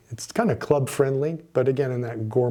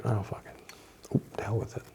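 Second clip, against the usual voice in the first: whispered curse under the breath; muttered swearing; 4 semitones lower, 10 dB quieter than usual. Real, not acted. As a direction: give up and swear to yourself, barely voiced. Fumbles the cap, gives up.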